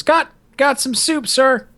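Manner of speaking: high-pitched, stammering recruit voice; scrawny young cadet. Retold or imitated. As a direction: imitate a timid kid answering his superior.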